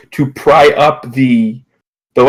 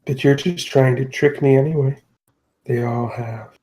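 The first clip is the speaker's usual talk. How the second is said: distrustful captor; 6 dB quieter than usual. Acted. Captor doubting the talking otter's promise, softly distrustful. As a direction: let it sink to a slow, quiet murmur.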